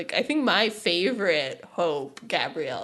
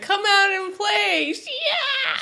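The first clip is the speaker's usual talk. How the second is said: high, loud call ending in a shrieked war cry; playful mock battle call; imitated taunting yell; 12 semitones higher, 4 dB louder, much slower than usual. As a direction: taunt someone hiding, then whoop. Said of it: "she just yells it into the cave".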